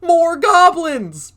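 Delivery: slow, very high, loud cry then a laugh; triumphant reveal. Acted. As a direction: announce it like a big surprise, then cackle. Cave voice reveals goblins.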